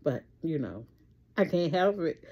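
ordinary talk

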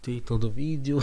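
ordinary delivery

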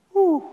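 a very high, loud whistling exhale of shock; real stunned whew after reading a frightening verse. Real, not acted. Reacting to terrifying passage.

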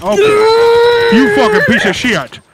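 high-pitched furious cursing; enraged shout. Acted. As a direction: explode into shrieked swearing. Officer rages at being killed.

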